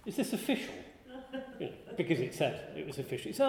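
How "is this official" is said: naive. Retold or imitated. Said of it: "Mister Naive".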